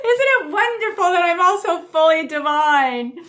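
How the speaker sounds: high, loud words through real laughter; laughing. Real, not acted. Leader laughing in exercise.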